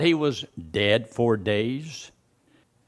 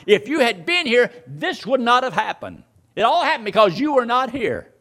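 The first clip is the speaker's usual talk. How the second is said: high, insistent reproach; accusing, mixed emotions; 7 semitones higher than usual. Retold or imitated. Preacher voicing mourners blaming Jesus for Lazarus's death, accusing. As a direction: press the accusation in a raised pitch. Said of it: "You're talking about mixed emotions".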